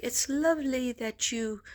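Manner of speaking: light Irish lilt on lovely; Irish accent. Retold or imitated. Retelling the Irish psychic's praise, a mild Irish lilt. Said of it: "I love the way the Irish talk".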